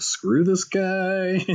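high, long-drawn brush-off; mock dismissal. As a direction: imitate fed-up producers, stretching each word. Imagined producers rejecting him.

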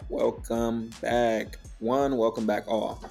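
usual speaking voice

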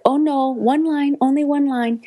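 high, slow voice of the woman; high-pitched retold reassurance; reassuring casting woman. Voicing Japanese woman at audition.

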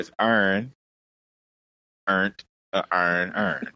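Baltimore vowels, "earn" swallowed into one sound; every word becomes "urn", Baltimore style; Baltimore accent. Shown as a demonstration. Explaining the phrase for the confused, Baltimore accent. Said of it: "But in Baltimore".

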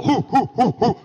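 hammed-up exertion puffs; mock workout grunts. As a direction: imitate a guy straining through push-ups. Imitating Steven's exertion grunts.

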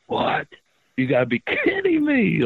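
high-pitched disbelief; stunned at who just walked in; retold disbelief. Retold seeing Sid arrive.

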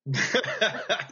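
bright burst of laughter, pitched high; real laugh. Real, not acted. Reacting to guest's joke.

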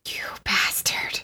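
hushed, slow, whispered curse; whispered insult. Acted. Player reacts to Peter's brief moment, whispered curse. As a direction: curse them under your breath, drawn out.

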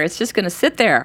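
ordinary talk

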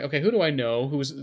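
normal talking voice

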